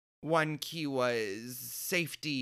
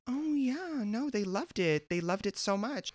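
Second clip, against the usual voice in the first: high, sugary gloat; mock-sweet gloating; 7 semitones higher than usual. Telling a hostile coworker they loved it, mock-sweet gloating. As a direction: rub it in with a high, put-on sweetness. Retold gloating reply to rival.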